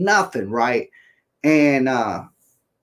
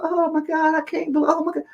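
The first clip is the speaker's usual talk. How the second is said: put-on much higher, squealy sweet girlfriend; 12 semitones higher, 3 dB quieter, faster than usual. Girlfriend suddenly sweet to her hurt boyfriend, high squeal. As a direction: gush it in a high girly voice. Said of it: "she was like".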